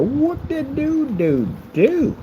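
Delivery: high, stretched-out silly whooping; sing-song nonsense whoop, drawn long; goofy "whoop-de-doo". Pretend. Goofy exclamation while showing the build.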